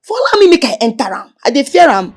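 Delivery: loud protest while restrained; angry shouting. Acted. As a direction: yell at the people holding him back. Held back, shouting to fight.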